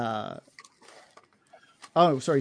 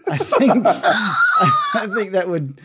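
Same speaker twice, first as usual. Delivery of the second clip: laughing; 8 semitones higher, 5 dB louder, much slower than usual. Real, not acted. DM laughing mid-sentence.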